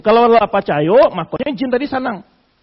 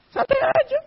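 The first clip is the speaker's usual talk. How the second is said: casual jinn voice; 5 dB quieter than usual. Retold or imitated. Jinn's line recalled.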